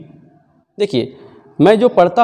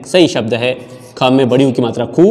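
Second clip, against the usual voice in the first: usual speaking voice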